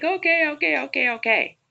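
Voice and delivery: high, hurried, frightened okays; scared giving in. Retold or imitated. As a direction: give in fast out of fear. Frightened woman gives in.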